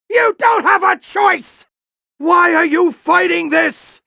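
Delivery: high, shouted plea; angry shout. Acted. General shouting at Ratchet to stop resisting. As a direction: yell it at him, desperate. Boss fight, pleading with Ratchet.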